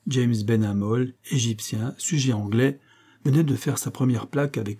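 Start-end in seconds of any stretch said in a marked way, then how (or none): none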